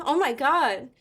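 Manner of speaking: usual speaking voice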